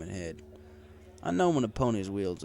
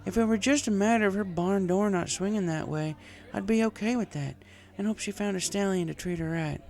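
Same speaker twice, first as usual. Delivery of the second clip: higher country mare voice, slurring a touch; Applejack voiced as drunk farm mare; 11 semitones higher, 3 dB louder than usual. A narrator's reading. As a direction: play the tipsy farm girl venting.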